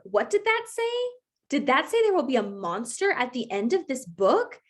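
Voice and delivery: high puppet voice, rising worry; building alarm as Grover; nervous furry monster asking. Acted. Reading Grover's lines aloud.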